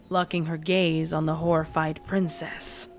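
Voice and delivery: usual speaking voice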